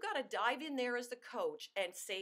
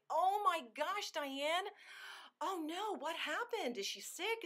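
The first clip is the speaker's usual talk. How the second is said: much higher, drawn-out alarmed "oh my gosh"; freaking out; 6 semitones higher, slower than usual. Shown as a demonstration. Consultant hearing of the first cancellation, freaking out in a friendly way. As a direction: react big, as if stunned by bad news. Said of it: "You know, really react".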